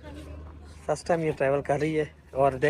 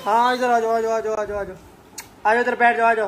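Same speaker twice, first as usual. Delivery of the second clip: higher, sing-song calling to a little one; 6 semitones higher than usual. Real, not acted. Coaxing a child to come over.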